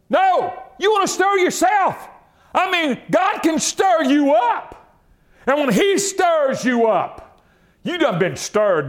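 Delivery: high, forceful pulpit cry; fervent exhortation at full force; preaching. Real, not acted. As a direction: cry it out high, stretching the phrases.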